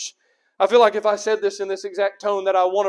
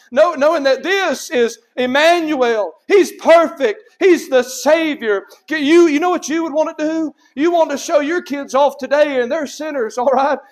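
high, loud pulpit delivery; raised, emphatic preacher's voice; forceful preaching; 6 semitones higher, 6 dB louder than usual. Real, not acted. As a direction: ring it out to the congregation.

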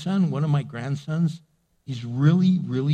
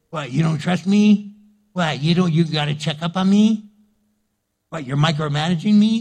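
defensive retort; 3 semitones higher, 6 dB louder than usual. Acted. Acted defensive worker quarrel.

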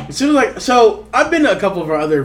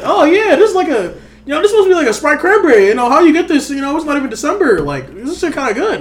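high, bright, clueless voice; naive younger self; 11 semitones higher, 4 dB louder than usual. Retold or imitated. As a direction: play your clueless past self, pitched up. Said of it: "And I was like".

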